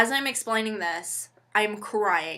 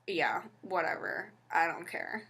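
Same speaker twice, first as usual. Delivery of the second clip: much softer, couldn't-care-less mumble; bored driver; 4 semitones lower, 10 dB quieter than usual. Retold or imitated. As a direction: brush her off, barely bothering to speak. Indifferent bus driver quoted.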